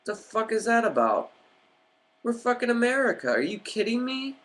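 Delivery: real exasperation breaking out of him; outraged disbelief. Real, not acted.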